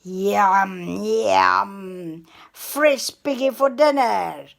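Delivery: loud gloating wolf; greedy wolf. A narrator's reading. Wolf gloating over fresh piggy for dinner, loud. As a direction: relish every word of the feast. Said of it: "he cried".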